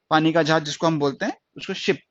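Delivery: ordinary talk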